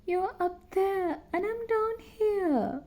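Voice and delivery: high, slow tearful voice; a touch of tearful sobbing. A narrator's reading. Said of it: "Sally sobbed".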